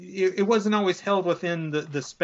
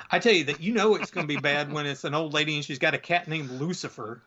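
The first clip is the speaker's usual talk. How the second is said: ordinary talk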